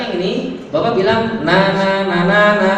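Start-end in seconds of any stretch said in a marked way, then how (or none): 1.4-2.7 loud nasal "na" buzzing through the nose; nasal na demo. Shown as a demonstration. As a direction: hum each "na" strongly through the nose. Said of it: "Bunyinya harus persis".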